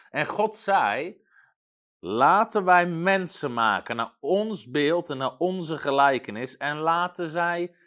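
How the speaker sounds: slow, loud Bible proclamation; scripture reading. Acted. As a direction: proclaim the verse with force and measured pauses. Reads the Genesis passage aloud.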